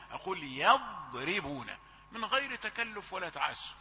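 normal talking voice

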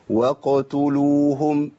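very slow chanted "وقتلوهم", qaf echoed before a rounded taa; clean qalqala. Shown as a demonstration. Teacher modelling the verse, "وقتلوهم" slowly chanted with echoed qaf. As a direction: draw out "وقتلوهم", each letter cleanly finished. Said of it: "أقول إيه؟".

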